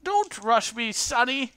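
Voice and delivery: put-on high, loud, cranky old-lady voice; elderly and put out; crotchety old woman. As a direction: scold the youngsters as an old woman. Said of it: "the little crotchety woman".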